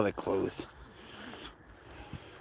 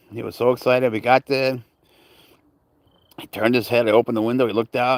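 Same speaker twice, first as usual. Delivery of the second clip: usual speaking voice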